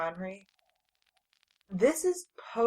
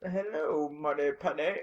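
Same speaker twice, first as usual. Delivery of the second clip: badly faked Connery accent, more posh than Scottish; Sean Connery impression; 4 semitones lower, 4 dB quieter than usual. Shown as a demonstration. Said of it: "here's my attempt".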